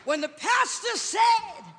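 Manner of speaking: shouted, high preaching climax; preaching at full force. Acted. Preacher shouting to the congregation, loud and high. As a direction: whoop it up to the rafters.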